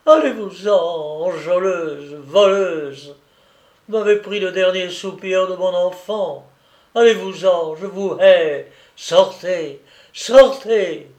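loud furious shout, building to a scream; a grieving mother driving her away in rage; angry trembling cry. A narrator's reading. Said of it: "cria d'une voix tremblante de colère". (cri furieux, tremblant de colère)

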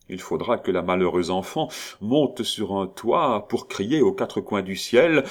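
ordinary delivery, nothing marked

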